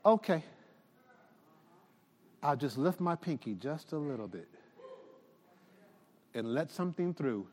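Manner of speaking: soft, low, gentle voice of God; loving kindness. Acted. God speaks gently to a tired believer, soft and tender. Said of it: "in his loving kindness".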